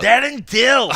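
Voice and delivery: high, loud call of a name across a bar; shouted name, half joking; mock bar yell. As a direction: yell it out like a drunk fan. Reenacting yelling at the bar.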